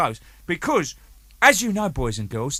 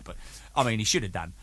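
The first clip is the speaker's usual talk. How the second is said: ordinary talk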